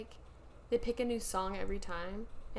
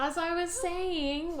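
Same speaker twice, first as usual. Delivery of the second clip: drawn-out, higher, mock-huffy; pointed resumption; 6 semitones higher, much slower than usual. Real, not acted. Host cutting back in after co-host's interruption, slow pointed resumption. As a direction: stretch it out slowly and pointedly, pitched up.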